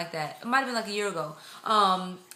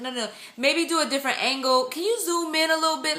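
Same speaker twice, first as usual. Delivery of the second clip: higher-pitched retold protest; insistent pleading; 7 semitones higher than usual. Mother-to-be asking the sonographer for another angle, insistent pleading. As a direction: push for another look, voice climbing.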